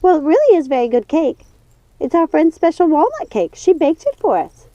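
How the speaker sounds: can't stop about the cake; prattling witch. Acted. Martha defends her cake.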